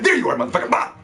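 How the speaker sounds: excited gotcha. Pretend. Acting out snapping Butterfree.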